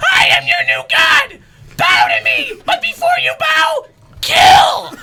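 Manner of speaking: very high, loud screech; screaming. Acted. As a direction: screech like a crazed god. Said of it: "I scream".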